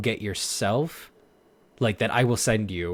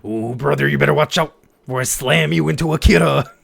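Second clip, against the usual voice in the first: wrestler promo; 2 semitones higher, 8 dB louder than usual. Pretend. Repeats wrestler bit.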